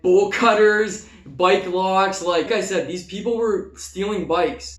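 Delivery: usual speaking voice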